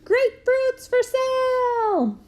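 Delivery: high, loud, slow hawker's cry; street vendor yell. Retold or imitated. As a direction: bellow it from the street corner. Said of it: "yell at the top of my voice".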